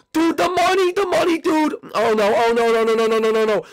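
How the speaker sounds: high, loud wail of dismay; panicked despair. Acted. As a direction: cry out as the loss sinks in. Discovers the money was stolen.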